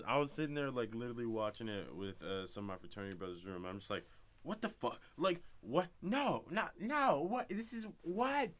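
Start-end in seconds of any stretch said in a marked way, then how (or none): 4.4-8.5 climbing much higher, louder, stunned; disbelief. Real, not acted. Fan reliving the shocking ending, rising disbelief.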